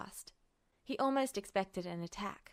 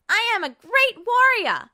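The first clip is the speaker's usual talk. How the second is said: much higher, much louder boastful yell; defiant shout; 16 dB louder than usual. A narrator's reading. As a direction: scream out her pride while struggling. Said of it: "she shouted".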